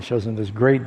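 usual speaking voice

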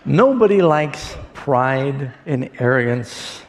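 ordinary talk

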